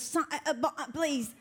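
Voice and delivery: imitated Cockney, choppy and garbled; choppy mock Cockney, syllables bitten off like a sneeze. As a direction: mimic an unintelligible Cockney waitress. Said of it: "It's real choppy".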